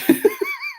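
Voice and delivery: high giggle; high, amused laughing; real laugh. Real, not acted. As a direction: giggle at your own admission. Her own laugh at the admission.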